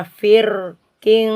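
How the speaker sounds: slow fir king run together; linked thin r; thin r joined. Shown as a demonstration. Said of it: "jadi terus".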